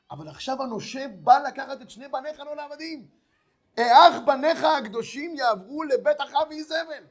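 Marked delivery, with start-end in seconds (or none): 3.8-7.0 slow, loud, higher wail of a desperate mother; widow's loud, slow lament; anguished outcry. Acted. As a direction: cry it out slowly in anguish. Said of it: "זה כל צעקתה".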